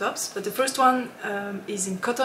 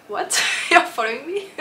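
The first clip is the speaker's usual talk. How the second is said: higher, incredulous question with a laugh; retold amazement; 5 semitones higher, 3 dB louder than usual. Retelling meeting a designer who follows her, mock-amazed. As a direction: act out delighted disbelief, laughing.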